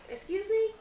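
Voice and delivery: high, innocent feigned confusion; playing dumb. Actress playing dumb to a fan, high feigned innocence. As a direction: act like you have no clue. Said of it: "'Cause I just stare at them, like".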